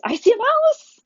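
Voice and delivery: shocked, high, loud squeal; super surprised. Shown as a demonstration. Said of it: "I'm gonna sound super surprised".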